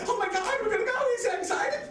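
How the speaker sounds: very high, gushing girlfriend imitation; high-pitched, hammed-up glee; mock excited. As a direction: squeal it like an overexcited girlfriend. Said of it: "she's all fucking excited".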